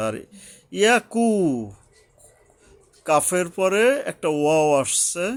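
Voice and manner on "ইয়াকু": ইয়াকু with a long drawn-out final u; raised, louder ইয়াকু, lengthening the কু; one-alif stretch. Shown as a demonstration. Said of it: "এখানে এক আলিফ টানতে হয়". (ইয়াকু-র কু এক আলিফ টেনে পড়া)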